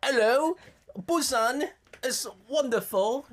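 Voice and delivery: slow, high mock-British accent; bad British accent. Host showing they can't do British, bad British accent. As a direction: put on a deliberate, drawn-out British accent. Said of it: "We can't do British accents either".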